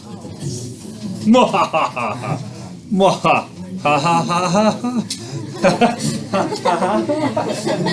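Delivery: long cartoon villain cackle that fades out; evil Scooby-Doo laugh. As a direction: cackle like a cartoon villain until you run out of steam. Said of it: "My, my evil Scooby-Doo laugh".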